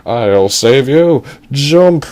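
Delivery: slow, loud mock-heroic shout; drawn-out hero's cry, rising on the last word; heroic call. Captain calling to the falling girl, hammed-up heroic shout.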